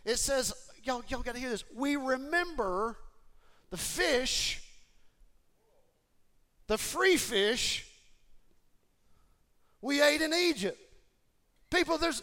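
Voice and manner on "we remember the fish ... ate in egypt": high, very slow, dreamy longing; mock nostalgic whine. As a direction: savour each word like lost treasure. Scripture quote of nostalgic complainers.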